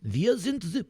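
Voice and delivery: high, soft mock German rant; German accent. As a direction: strain for a German accent in a squeaky register. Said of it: "do a German accent".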